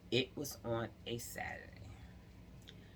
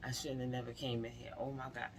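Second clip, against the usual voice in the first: softer, fast frightened murmur; shaken panic; 7 dB quieter, faster than usual. Retold or imitated. Herself in the office, softly panicking. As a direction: mutter it to yourself, trembling with regret. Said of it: "I'm shaking".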